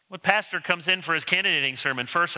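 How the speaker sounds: normal talking voice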